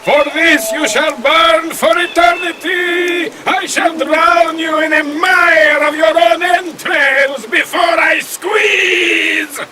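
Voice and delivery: high, ranting, menacing threat; a bound demon vowing revenge; furious demon. Acted. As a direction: roar the threat at her. Demon rages at the girl.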